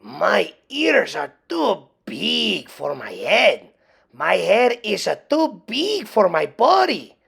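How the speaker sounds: slow, put-on Spanish accent. A narrator's reading. Kitten in front of the mirror, in a Spanish accent. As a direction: play it like a proud little Spanish dog. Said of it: "using his very best Spanish accent".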